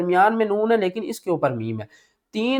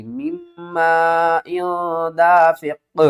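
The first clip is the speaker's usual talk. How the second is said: very slow chanted tajweed; slow melodic recitation of مما ان دافق; Quranic recitation; much slower than usual. Shown as a demonstration. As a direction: draw it out like recitation. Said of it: "میم کے ساتھ میم مل جائے گی".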